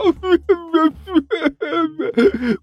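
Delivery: high drawn-out wail; wailing sob. A narrator's reading. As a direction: burst into a long high wail. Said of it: "一滴泪水".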